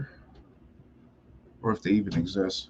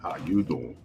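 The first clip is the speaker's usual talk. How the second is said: lightly voiced low Joey pickup line; a hint of Joey Tribbiani charm; Joey from Friends; 5 semitones lower, 6 dB quieter than usual. Shown as a demonstration. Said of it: "you gotta do the Joey from first".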